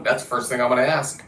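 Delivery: usual speaking voice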